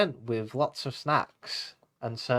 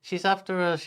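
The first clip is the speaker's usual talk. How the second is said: mock panicked yell; 6 semitones higher than usual. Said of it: "yelled Pufferfish".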